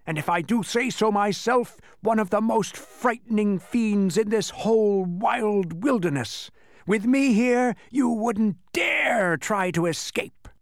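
high, loud creature threat; boastful menacing demon. Acted. Fiend warning captives against escape, high loud menace. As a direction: stretch the words to scare them.

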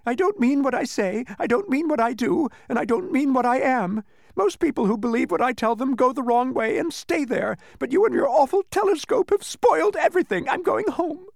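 very high, loud wail; hysterical crying. Acted. Exposed demon giving up and going home, hysterical. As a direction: escalate the tearful wail to a peak. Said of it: "And crying hysterically".

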